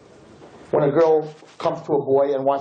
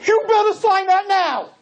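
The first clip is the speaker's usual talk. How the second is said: very high, loud demand; mother's scream; 4 dB louder than usual. Retold or imitated. Mother screaming at the boy to sign, loud and high. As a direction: shout it across the room. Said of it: "she screams across".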